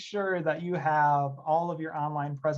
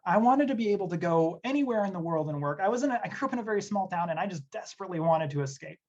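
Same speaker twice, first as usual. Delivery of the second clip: ordinary delivery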